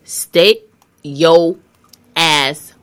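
loud, punchy; emphatic. Real, not acted. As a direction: drive each word home.

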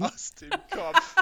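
high, slow words through real laughter; laughing. Real, not acted. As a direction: squeeze the words out while laughing. Laughs at friend's request.

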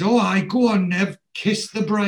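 high, loud urging; egging on. Retold or imitated. Dennis urging Nev to kiss the bride, loud and jovial. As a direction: goad your mate along with gusto.

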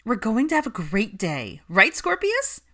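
put-on pep up a small child; animated. A narrator's reading. Nanny cheerfully coaxes the little boy, animated. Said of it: "sounding animated in a way that would appeal to most children".

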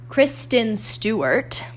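KRISTEN STEWART slowly and crisply enunciated; careful enunciation. Real, not acted. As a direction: draw out each syllable of Kristen Stewart, carefully. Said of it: "gotta get that enunciation on point".

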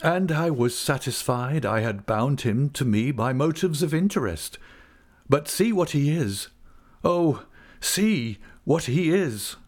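high, pleading lament; ruined man begging to be understood; anguished father. Acted. Narrator voices Wickfield's anguish.

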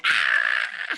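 sharp yelp of fright, low and loud; retold scream. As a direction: let out a startled yell flying downhill. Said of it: "Like, screaming".

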